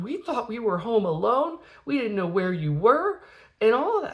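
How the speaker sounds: high, whiny imitation of a tearful child; mock crying. Daughter who felt abandoned, high mock-tearful pleading. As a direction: plead like a distraught little kid. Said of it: "she's, like, crying".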